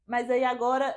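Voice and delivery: ordinary delivery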